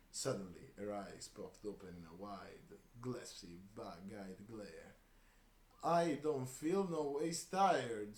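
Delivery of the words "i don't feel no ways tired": slow, loud, much higher put-on Southern accent; bizarre Southern accent. Old woman wakes up and blurts in a slow, loud, fake Southern accent. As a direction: stretch it out in a hammed-up Southern accent. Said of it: "in a bizarre attempt at Southern accent".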